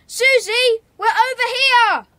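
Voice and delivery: loud, high call across the dark woods; cupped-hands call into the night. Acted. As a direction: shout to be found. Calling out to friends in the dark.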